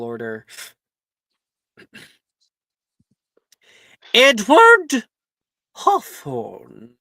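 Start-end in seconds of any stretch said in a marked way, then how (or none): 4.1-6.9 calling out a name. Acted. McGonagall calls name.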